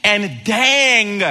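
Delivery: high, loud protest with a drawn-out last word; mock-defensive, insisting on innocence; arguing. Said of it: "we're arguing like".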